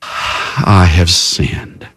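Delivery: low, very slow, with a heavy sigh; sigh, then admit it slowly and low; sighed confession. Acted. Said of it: "says with a sigh".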